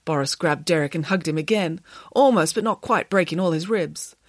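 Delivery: plain reading voice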